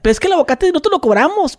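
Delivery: a lightly voiced vendor excusing himself; mock vendor voice.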